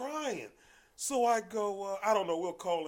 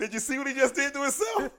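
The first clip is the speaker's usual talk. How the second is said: high, loud disbelieving question; retold high-pitched outburst; incredulous protest; 13 semitones higher, 13 dB louder than usual. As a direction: holler it back at the nurse in disbelief. Incredulous refusal to the nurse, retold.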